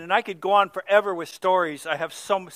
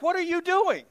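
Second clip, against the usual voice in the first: puzzled mother; 11 semitones higher than usual. Retold or imitated. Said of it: "she's just looking at me like".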